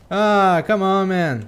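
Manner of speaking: loud exasperated complaint; frustrated outburst. Real, not acted. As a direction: let the frustration burst out. Frustrated at teammate's play.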